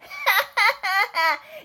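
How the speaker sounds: sudden high-pitched cackle; high squealing laugh. Retold or imitated. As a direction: let a shrill laugh burst out. Laugh after his outburst.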